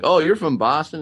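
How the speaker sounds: mocking a Boston accent, pitched high; a crappy fake Boston accent, teasing; bad Boston accent. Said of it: "a really shitty Boston accent".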